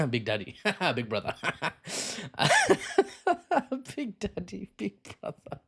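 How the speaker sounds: real giggling through the words; laughing at own joke. Real, not acted. Amused by own Big Brother joke, laughing. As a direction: crack up while repeating it. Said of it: "I laugh at my own jokes".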